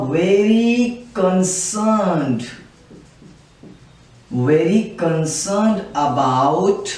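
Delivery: words drawn out very slowly, long pauses; slow dictation. Real, not acted. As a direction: dictate slowly as you write. Writing the meaning while dictating. (लिखते हुए बहुत धीरे बोलना)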